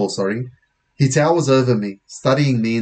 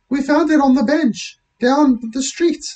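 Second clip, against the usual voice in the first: high, put-on girlish voice; girl's voice; 14 semitones higher than usual. Lisa explaining to police, high girlish voice. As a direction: pitch it up as a young girl.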